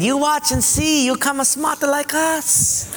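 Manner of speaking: mock Japanese accent. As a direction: draw it out like a smug Japanese traveller. Japanese guy boasts in accent.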